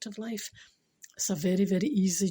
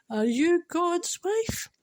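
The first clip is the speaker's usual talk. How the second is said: high, slow, tearful punchline; high voice, half crying, half laughing; through tears; 9 semitones higher, much slower than usual. Real, not acted. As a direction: drag the question out while choked with emotion. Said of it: "through my tears and my emotion".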